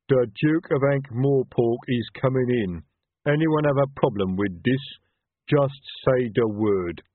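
slow, loud troll warning; heavy troll guard, slow and firm. Acted.

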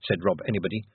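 ordinary delivery, nothing marked

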